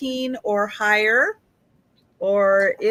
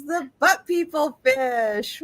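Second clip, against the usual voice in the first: ordinary delivery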